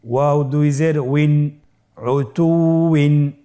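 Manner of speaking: slow, measured recitation with held vowels; Quranic spelling drill. Shown as a demonstration. Teacher spelling Quran words for learners, slow drill recitation.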